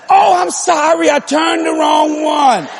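high, slow, drawn-out apology; imitated apologetic wife. Wife apologises for turning wrong knob, imitated drawn-out call.

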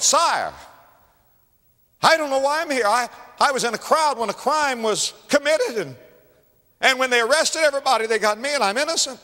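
high, loud plea of an aggrieved prisoner; acted indignant protest to a king; protesting innocence. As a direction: plead your innocence at a high pitch.

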